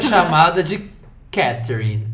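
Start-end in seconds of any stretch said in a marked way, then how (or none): 1.3-2.1 put-on British accent. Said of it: "Com sotaque britânico".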